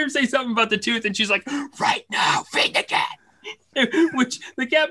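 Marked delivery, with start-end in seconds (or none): 1.8-3.1 hissed whispered order; urgent whisper. Retold or imitated. Father imitating his wife hurrying the boy out, whispered. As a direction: whisper it sharp so he moves now.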